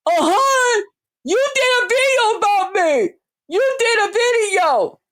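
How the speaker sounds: high, wailing mimicry of her; mock whine. Mimicking the rival insisting on the video, mock high whine. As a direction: whine it high as a mocking imitation. Quoting the other woman's whiny retort.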